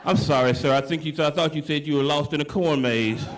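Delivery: low, disbelieving operator voice; a deep-voiced dispatcher who can't believe what he heard; 911 operator.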